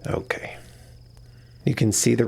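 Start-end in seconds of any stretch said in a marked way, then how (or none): 0.1-0.6 breathy relieved whisper. Acted. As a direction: sigh it out as sleep takes over. Relieved, drifting off to sleep.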